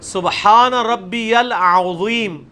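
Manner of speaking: سبحان ربی العظیم drawn out slowly, throaty ain in العظیم; slow recitation. Shown as a demonstration. Teacher modelling correct العظیم for students, slow. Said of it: "تسی پڑھ دیو عظیم".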